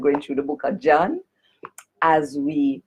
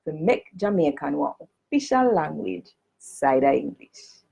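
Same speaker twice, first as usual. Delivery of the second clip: "official language side of English" in Jamaican patois, "side of" for alongside. Shown as a demonstration. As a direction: read "make Jamaican one official language side of English" in broad Jamaican Creole. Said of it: "read it first in a the Jamaican language".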